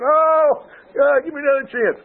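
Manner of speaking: high, loud mock begging; desperate plea, half-joking; pleading cry. Glenn begging God in retold scene.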